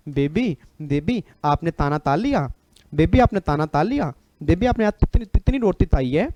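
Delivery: put-on lisping lovey-dovey baby talk with rising, swooping ends; mock a lovestruck lisp, swapping k sounds for t; तोतला baby talk. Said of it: "आदमी दो ही बार तोतला होता है". (तोतली प्यार भरी आवाज़ की नकल)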